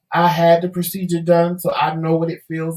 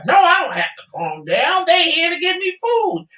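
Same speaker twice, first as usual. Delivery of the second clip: much higher, fast, goofy begging voice; mock drugged husband; 8 semitones higher than usual. Wife imitating husband begging nurses for coffee, high goofy voice. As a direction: ham up a loopy patient asking for coffee. Husband loopy refusing to calm down.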